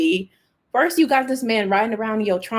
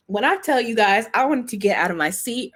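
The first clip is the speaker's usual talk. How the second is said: normal talking voice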